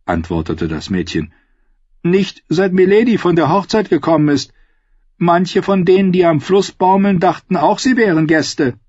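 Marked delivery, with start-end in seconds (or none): 2.0-8.7 put-on high-pitched girl's voice; high, hard young voice; stern girl. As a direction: rebuff her in a high girlish voice.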